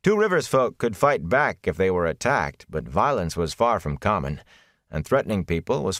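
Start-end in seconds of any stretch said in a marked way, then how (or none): none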